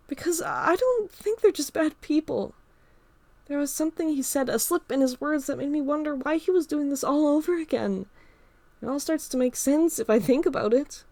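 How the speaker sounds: put-on girl confiding about bullies; Emma recounting. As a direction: tell the story of the attacks in a girl's voice. Emma explaining.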